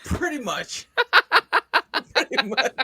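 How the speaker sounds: breaking into real laughter mid-word; squeezed out between laughs; laughing. Real, not acted. Laughing hard at the punchline.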